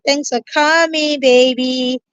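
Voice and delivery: ordinary talk, nothing marked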